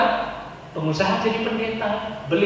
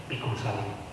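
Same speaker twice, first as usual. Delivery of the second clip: solemn refrain; 12 semitones lower, 13 dB quieter than usual. Shown as a demonstration.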